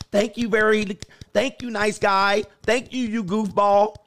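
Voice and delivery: high-pitched imitation of a patronizing date; mock dismissive girl. Girl thanking her nice-guy date, in a mock dismissive voice.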